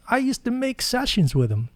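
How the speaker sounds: high put-on Mac voice; tossing it off like an old-timer; imitating Mac. Mac casually telling the narrator about old sessions, mock voice. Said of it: "He goes".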